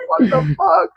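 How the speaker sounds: high, squealing laugh through words. Real, not acted. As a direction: crack up mid-question. Friends cracking up mid-talk.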